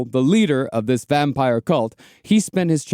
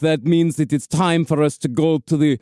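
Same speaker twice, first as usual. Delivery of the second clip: mock vampire voice; 2 semitones higher than usual. Parodying vampire clan speech.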